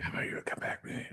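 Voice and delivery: barely voiced mock whisper; imitating a whisper. Storyteller quoting a come-on to his friend, mock whisper. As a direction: whisper it like a furtive invitation. Woman's invitation in retold story.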